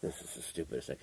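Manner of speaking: barely audible imitated whisper; quiet griping. Snobs not making any sound at a trailer, whispered griping. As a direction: grumble under your breath in a cinema. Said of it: "We're just doing that quiet".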